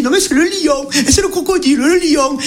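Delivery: high-pitched mock squabble; bickering. Two safari tourists argue over the animal, high bickering. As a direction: trade contradictions, pitch right up.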